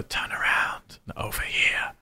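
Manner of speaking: eerie unvoiced whisper. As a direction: breathe it like a ghostly voice. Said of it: "human voice whispering".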